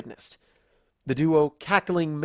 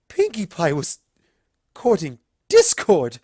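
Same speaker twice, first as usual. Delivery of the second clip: scandalised pony voice; appalled Rarity; 6 semitones higher, 8 dB louder than usual. A narrator's reading.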